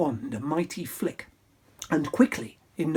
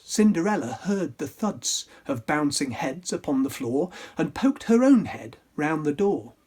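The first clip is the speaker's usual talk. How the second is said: ordinary delivery, nothing marked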